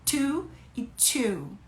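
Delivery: slow, soft breathy Two e two; whispered two. Shown as a demonstration. Teacher whispering to and two for a viewer. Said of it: "Faz um soprinho maior e two".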